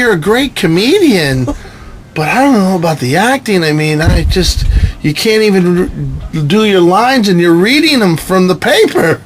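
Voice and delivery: a hint of a critical wife's voice; doubting wife. Wife critiquing her husband's acting, mild mock doubt.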